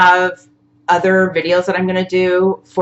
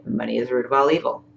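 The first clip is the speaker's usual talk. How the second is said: softer, quick echo of the leader's line; repeating back softly; 5 semitones lower, 7 dB quieter, faster than usual. Shown as a demonstration. Student echoing the teacher's tapping sentence, softly and quickly. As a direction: mumble it back fast like a follower. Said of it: "and you're gonna say".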